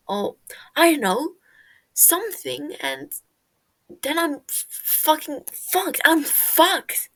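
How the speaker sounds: loud, high, breaking panic; panicked rant. Acted. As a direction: spiral into panic, voice climbing. Tommy spiraling in panic.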